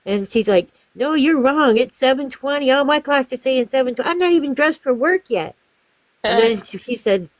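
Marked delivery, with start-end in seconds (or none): 1.0-5.5 high, emphatic contradicting mimicry; imitated arguing customer. Customer not dressed for work disputes the time, arguing. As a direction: argue back in her raised voice. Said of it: "she kept arguing with me".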